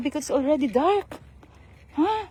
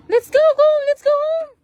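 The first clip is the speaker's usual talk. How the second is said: calling out; 10 semitones higher, 6 dB louder than usual. Real, not acted. Calling child to go home.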